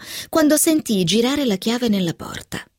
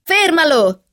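higher-pitched urgent cry, moderately raised; shouted command; 8 semitones higher, 4 dB louder, slower than usual. A narrator's reading. Narrator to Catherine as the cat attacks the keyboard, shouted command. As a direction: call out to stop him. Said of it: "gridai a Catherine".

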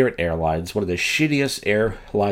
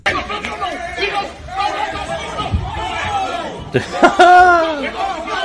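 high cackling laugh; 3 dB louder than usual. Real, not acted. Host laughing at the clip.

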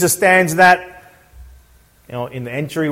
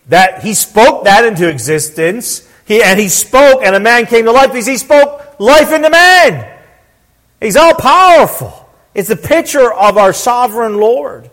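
raised, emphatic pulpit delivery; forceful, exultant sermon climax; impassioned preaching; 7 semitones higher, 5 dB louder than usual. Real, not acted. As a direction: proclaim his power to the congregation.

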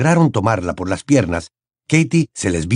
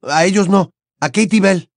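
urgent; 9 semitones higher than usual. A narrator's reading. Harry urges Hagrid.

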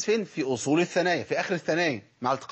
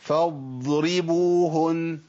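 drawn out far too long; overlong vowels; 2 semitones higher, 3 dB louder, much slower than usual. Shown as a demonstration. Teacher drawing the word out too long. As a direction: stretch every sound too much. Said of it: "ده كتير".

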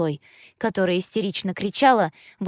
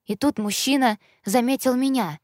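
ordinary delivery, nothing marked

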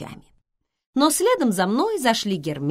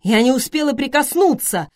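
higher, louder plaintive protest; complaining; 8 semitones higher, 8 dB louder than usual. A narrator's reading. Schweiger tells how the muse clawed him, complaining. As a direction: pour out a grievance. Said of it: "пожаловался Швайгер".

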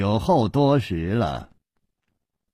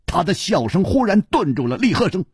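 ordinary delivery

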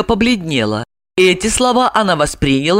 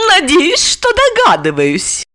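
coy and playful, lifted high at the start; flirtatious; 10 semitones higher than usual. A narrator's reading. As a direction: flirt with the answer. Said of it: "кокетливо ответила Ольга".

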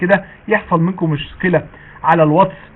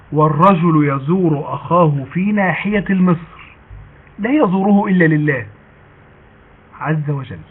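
hadith recitation; slower than usual. Acted.

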